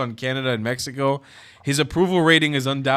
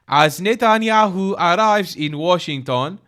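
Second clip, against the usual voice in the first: put-on accidental Farsi accent; 6 semitones higher, 7 dB louder, slower than usual. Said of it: "I think that's my Farsi accent".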